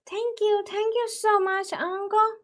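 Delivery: put-on high childlike thanks; sweet high grateful child; little girl voice.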